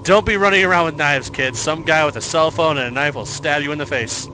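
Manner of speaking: mock scolding. Real, not acted. Scolding game kids.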